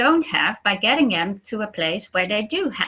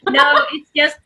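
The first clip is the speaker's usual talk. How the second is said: ordinary talk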